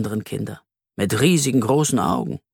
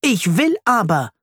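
put-on child shouting; 8 semitones higher than usual. A narrator's reading. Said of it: "schreit er".

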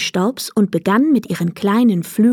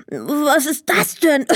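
slow, higher puzzled question; 6 semitones higher, slower than usual. A narrator's reading. Boy Emil puzzles over dust in his face, slow and surprised. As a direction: wonder what just hit your face. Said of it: "fragte er verwundert".

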